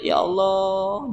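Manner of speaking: high, drawn-out, soft plaintive cry to God; mildly acted lament, stretched out; retold sadness. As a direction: softly wail it, imitating a sorrowful moment. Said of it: "Sedih gitu kan".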